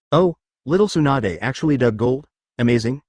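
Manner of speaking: usual speaking voice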